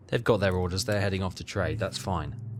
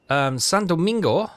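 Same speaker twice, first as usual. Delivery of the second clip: San Domingo called out higher and louder; odd high delivery; 7 semitones higher, 6 dB louder than usual. Real, not acted. Player hams up a Caribbean place name, high and loud. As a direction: announce the place name with a flourish. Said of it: "Don't know why I said it like that".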